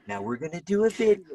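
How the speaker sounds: lightly hammed-up influencer voice; fake vlogger cheer. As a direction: play up a phony video intro. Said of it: "I don't wanna be like".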